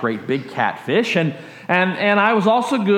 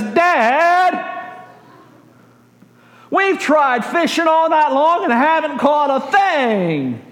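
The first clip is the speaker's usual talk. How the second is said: put-on whiny kid; 9 semitones higher, slower than usual. Peter answering Jesus like a kid.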